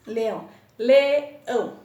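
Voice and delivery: leão, then a loud, slow LE - ÃO; teacher sounding out LE-ÃO; word, then syllables. Shown as a demonstration. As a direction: say leão, then pull it apart as LE, ÃO. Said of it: "dois pedacinhos".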